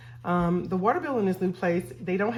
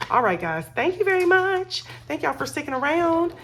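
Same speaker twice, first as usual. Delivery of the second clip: much higher and louder, beaming; bubbly sign-off; 10 semitones higher, 5 dB louder than usual. Real, not acted. Thanking viewers at the end of the vlog, bubbly and high. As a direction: sing out your thanks to the viewers.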